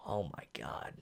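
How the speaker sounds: hushed, barely voiced exhale; awed whisper. Real, not acted. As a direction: whisper it, overwhelmed. Awed after emotional recap.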